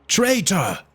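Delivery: a high angry roar of "Traitor!" from the mob; "Traitor!" roared high by the crowd. A narrator's reading. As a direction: hurl "Traitor!" back at him. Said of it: "they roared back".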